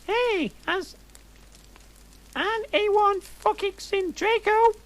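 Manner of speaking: high, put-on goth girl squeal; gothic voice. Narrator reading her heroine asking about Draco in a high goth voice. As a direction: camp it up as a mopey goth. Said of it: "I asked gothically".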